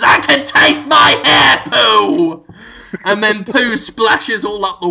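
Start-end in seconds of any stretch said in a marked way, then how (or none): 0.0-2.5 higher, louder hammed-up scream; cartoonish screamed line; mock scream. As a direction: shriek it in gross-out horror. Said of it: "he screams".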